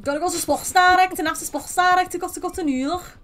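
put-on Scouser accent. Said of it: "She's a proper Scouser".

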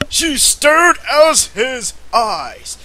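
slow, loud, high swoon; drawn-out drama. Acted. Reader swoons over the stare, slow and loud. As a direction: stretch it out dramatically.

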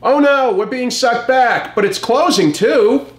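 high, hammed-up panicked call; offscreen shout. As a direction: yell it from off screen like a cartoon robot. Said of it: "somebody off screen is shouting".

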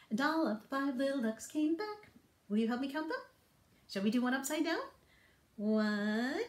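ordinary delivery

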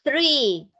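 THREE with a cold puff of air on TH; THREE drawn out, airy TH, falling tune. Shown as a demonstration. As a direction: blow air onto your hand as you start THREE. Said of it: "vas a pronunciar el número tres".

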